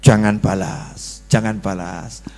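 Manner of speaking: low, slow self-restraint; a husband calming himself, low and slow; restraining himself. Acted. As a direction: hold yourself back quietly. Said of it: "sabarnya ditekan lagi".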